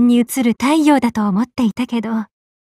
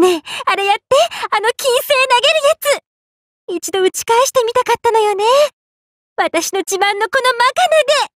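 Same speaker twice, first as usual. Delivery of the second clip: high, excited pestering; eager begging; 9 semitones higher, faster than usual. Acted. Asking Ishtar to throw Venus, eager begging. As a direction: pester a friend brightly and high.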